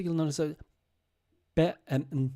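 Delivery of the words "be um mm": ordinary talk, nothing marked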